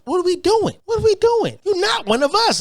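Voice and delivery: exasperated, high-pitched what-are-we-doing; ranting at Kamala Harris, exasperated and high-pitched; exasperated outburst. Real, not acted. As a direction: pitch jumps way up in disbelief.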